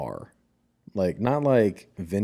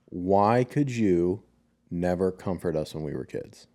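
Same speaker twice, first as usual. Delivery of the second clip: slow, drawn-out "Why could you", then a pause before "never"; retold demand, spaced out to land; insistent confrontation. Child makes mother answer for the past, slow and insistent. Insistent second asking, after "Look at me.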